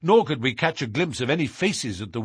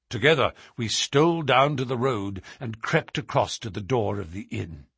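ordinary delivery

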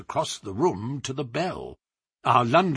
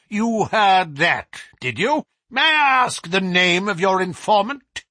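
higher, louder, pointed questioning; sharp professor's challenge, raised voice; challenging demand; 8 semitones higher, 6 dB louder than usual. A narrator's reading. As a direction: press the visitor hard for an answer. Professor challenges Holmes in story.

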